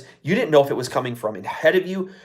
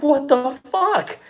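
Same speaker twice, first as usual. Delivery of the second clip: stunned disbelief; 11 semitones higher, much slower than usual. Retold or imitated. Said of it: "It was like".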